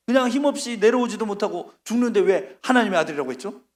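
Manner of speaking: ordinary talk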